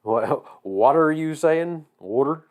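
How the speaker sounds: put-on drawn-out Missouri accent, questioning. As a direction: imitate a puzzled Missourian, stretching the words. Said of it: "especially in Missouri".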